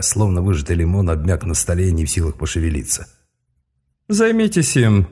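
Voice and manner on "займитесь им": high, slow, icy mage order. Acted. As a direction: coldly hand him over. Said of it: "ледяным голосом".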